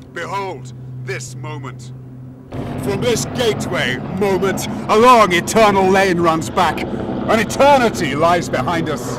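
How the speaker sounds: high and loud, declaiming; exalted proclamation. Acted. The wanderer proclaims eternity to the dwarf, exalted. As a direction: proclaim it like a prophet at the gate.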